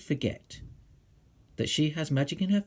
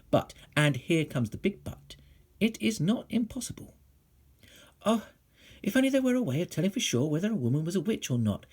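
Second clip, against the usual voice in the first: plain reading voice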